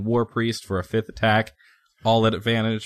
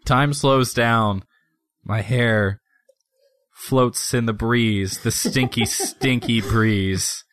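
slow-motion narration; slower than usual. Acted. Player describes slow-motion kill.

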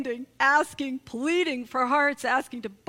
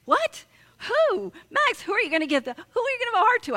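much higher, louder, fast and flustered; startled mom; 10 semitones higher, 4 dB louder, much faster than usual. Retold or imitated. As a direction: caught off guard, firing questions quickly. Mom startled by Max's demand.

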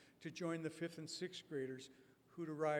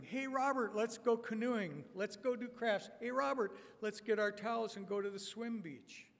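put-on eager kids inviting; 6 semitones higher, 5 dB louder than usual. Campers eagerly inviting Robert.

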